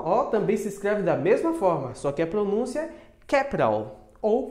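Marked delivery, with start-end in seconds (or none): 3.3-4.1 American "capital" as KAP-i-rul, t softened to a tap; American pronunciation. Shown as a demonstration. Teacher contrasting two variants for learners, American pronunciation. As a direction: say "capital" in American English, flapped t. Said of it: "no americano".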